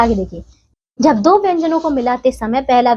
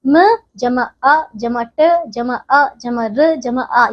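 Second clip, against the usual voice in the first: slow, each sound of मटर said apart with a pause; slow sound-by-sound spelling; much slower than usual. Shown as a demonstration. Teacher spelling मटर out slowly, sound by sound, for children. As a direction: break मटर into its sounds for the class. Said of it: "अब मटर में".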